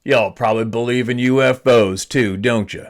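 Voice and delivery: imitated Southern hunter, slightly slurred; retold jab in a put-on Southern accent. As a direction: slur it at them in a Southern accent. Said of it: "Southern accent".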